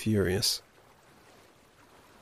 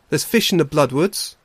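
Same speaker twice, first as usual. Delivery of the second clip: mildly frustrated, higher and louder; growing frustration; 4 semitones higher, 6 dB louder than usual. Acted. Leap argues with the otter, mildly frustrated. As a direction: push back with rising irritation. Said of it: "growing frustrated".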